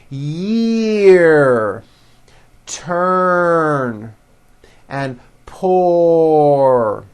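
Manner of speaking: "Year", "Turn", "pour" stretched out with a held R; slow model words, R pulled long; strong R. Shown as a demonstration. Teacher models words to repeat, "Year" and "pour" closing on long R. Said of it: "The R needs to be very strong".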